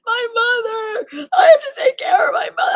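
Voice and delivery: retold high, wailing sob; sobbing. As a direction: wail it through mock tears. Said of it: "I'm sobbing in the back".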